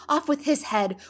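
high, very loud enraged command; furious shouting. A narrator's reading. Queen condemning players, furious shout. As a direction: shout the sentence in a rage. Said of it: "furious passion and went stamping about and shouting".

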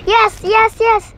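high happy yes yes; excited cheer. Real, not acted. Game success, cheering.

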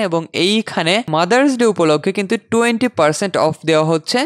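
ordinary delivery

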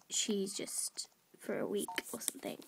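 whispered aside. Real, not acted. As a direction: drop to a secretive whisper.

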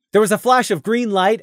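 ordinary delivery, nothing marked

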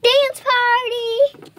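high, loud, stretched-out cheer; excited shout. Real, not acted. Child announcing a dance party to viewers, excited shout. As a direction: announce it like a big surprise.

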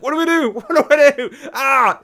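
high, loud mock panic; hammed-up frantic outburst. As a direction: act out an overwhelmed brain, laughing. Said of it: "a million things going through my head".

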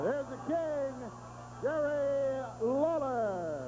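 slow, stretched announcer call; drawn-out ring announcement. Real, not acted. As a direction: announce him like a ring entrance. Announcer introduces the King.

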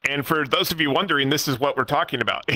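put-on celebrity impression capped with a signature chuckle; Seth Rogen. Shown as a demonstration. Guest demonstrating their old online voice for listeners, celebrity impression. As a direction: do the impression, ending in a repeated huh-huh laugh. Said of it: "permanently in a Seth Rogen voice".

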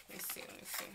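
soft breathy whisper to herself. Real, not acted. Vlogger checking the bottle, whispering to herself. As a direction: murmur it under her breath while looking.

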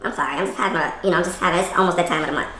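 low, softened apology; apologetic, emotional. Acted. Wife apologizing to husband after the quarrel, subdued. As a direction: back down and excuse yourself. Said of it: "I be a little emotional".

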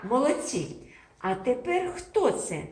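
ordinary talk, nothing marked

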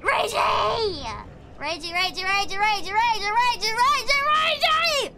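chanted tantrum climbing to a high yell; hammed-up rage, rising to a squeal; mock rage. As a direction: repeat it in a building play tantrum. Play-rages after barely winning.

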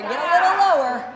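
loud, high push; coach shouting. Real, not acted. Coach pushing through the hold.

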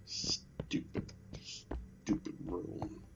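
hushed, slow whispered muttering; whispered grumble. Real, not acted. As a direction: whisper it under your breath. Muttering at the game, unvoiced.

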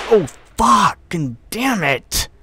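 loud, high, drawn-out curse; frustrated outburst. Real, not acted. Player curses the game, frustrated outburst. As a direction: explode in exasperation at the game.

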